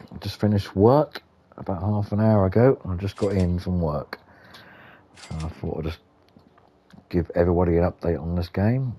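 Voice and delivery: usual speaking voice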